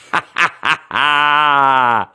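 high, drawn-out exultant laugh; delighted laugh. Real, not acted. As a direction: laugh out loud in relish. Savoring the favorite movie line.

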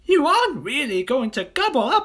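very high, loud cheeky voice; chirpy monkey. Acted. Monkey greets the crocodile, squeaky and cheeky. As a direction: chatter it up high.

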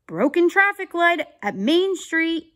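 high, loud officer's report; radio call-in. Acted.

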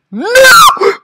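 high, loud scream of NO; frustrated scream. Real, not acted. Gamer failing the jump, frustrated scream. Fails in rage game.

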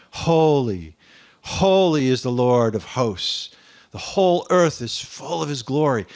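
loud, slow holy, holy; crying holy. Acted. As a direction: proclaim the holy cry out loud. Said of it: "one cried to another and said".

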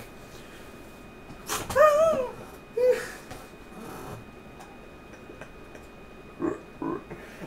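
long high squealing laughter; cracking up at the scene; high laughing fit. Real, not acted. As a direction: burst into helpless giggles. Laughing at Mason's exit.

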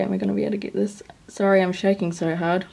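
normal talking voice

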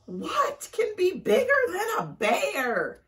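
slow, high, loud wail; yelling. A narrator's reading. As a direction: yell it as a scared storybook wolf. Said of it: "yelled the wolf".

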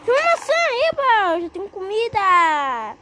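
high, loud happy squeal; excited cheer. Real, not acted. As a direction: cheer at finding food. Acha maçã no jogo. (gritinho animado de alegria)

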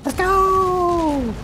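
very high, drawn-out cheer; hyped squeal. Acted. Player cheering in a game, high drawn-out squeal. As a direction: squeal it out, holding the last word.

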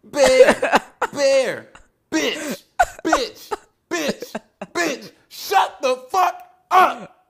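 high shouts building to a drawn-out yell; yelling back. Acted. Bear tells the screaming woman off, exasperated high yelling. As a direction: echo her yelling, then stretch the final order loud and high.

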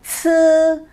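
sư with a strong puff of air, high; aspirated sư. Shown as a demonstration. Teacher showing learners the aspiration. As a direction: breathe hard out on sư. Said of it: "Bật hơi ra nhá".

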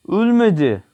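high, loud alarmed shout; sharp shout. Real, not acted. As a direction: yell it out at the screen. Gameplay enemy survived. (yüksek sesle bağırarak)

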